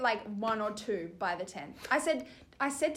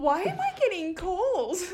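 mock confused mum; 7 semitones higher, 3 dB louder, slower than usual. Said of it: "Your mum's like".

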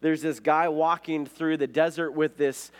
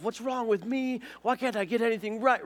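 high, strained-pitch rant without real volume; mock self-yelling; 8 semitones higher than usual. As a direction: act out yelling at yourself, voice pitched way up. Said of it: "then you start yelling at yourself".

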